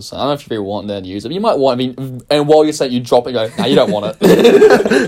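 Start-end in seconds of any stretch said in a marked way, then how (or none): 3.6-5.1 slow, loud, high, laughing quote; drawn out through laughter; laughing retelling. Retold or imitated. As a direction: laugh through the imitated line. Retelling friend while laughing at him.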